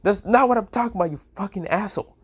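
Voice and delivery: high, loud indignant outburst; flustered anger. A narrator's reading. Red-faced Valerie correcting Issei, flustered anger. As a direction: build from protest to a high, cursing yell. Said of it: "face becomes red and says".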